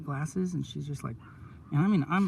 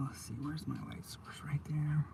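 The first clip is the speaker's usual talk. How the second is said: whispered to self; 9 dB quieter than usual. Real, not acted. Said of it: "whispered to self".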